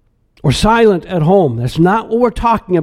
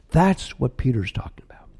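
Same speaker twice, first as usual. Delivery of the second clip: whispered; 6 semitones lower, 8 dB quieter than usual. Real, not acted. Hushed close after list.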